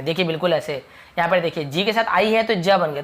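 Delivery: ordinary talk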